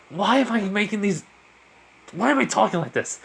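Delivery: acted mild bewilderment; baffled self-questioning. Hero baffled by own quips.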